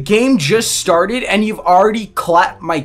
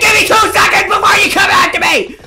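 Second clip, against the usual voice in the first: high frantic pleading shouts; panicked screaming; 13 semitones higher, 5 dB louder than usual. Real, not acted. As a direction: scream for mercy while being chased. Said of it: "I'm screaming like a girl!".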